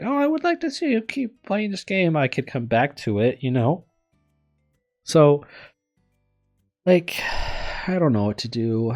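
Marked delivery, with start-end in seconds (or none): 0.0-2.2 much higher put-on fan voice; imagined viewer. Viewers asking for more of the series, high mock fan voice. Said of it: "people are like". 7.2-7.8 short voiceless sigh; real sigh. Real, not acted. Streamer unsure what to do, real sigh.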